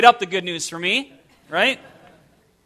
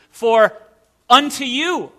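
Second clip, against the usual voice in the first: "unto you" raised high and drawn out; stress on "unto you"; 3 semitones higher, 3 dB louder than usual. Shown as a demonstration. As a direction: punch "unto you" after a held "For". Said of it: "emphasizing different words of".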